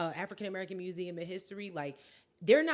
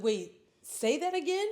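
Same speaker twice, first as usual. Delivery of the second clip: higher, mock-incredulous question; acted disbelief; 8 semitones higher, 4 dB louder than usual. Host recalling first hearing the news, incredulous. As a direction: replay being taken aback, pitched up. Retells own disbelieving reaction.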